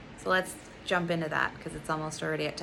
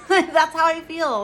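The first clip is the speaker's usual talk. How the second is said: laughing; 9 semitones higher, 10 dB louder than usual. Real, not acted. Laughing after the mock voice.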